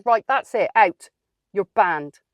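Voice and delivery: the manager loses it and throws her out; mock furious shout. Manager voiced banning her.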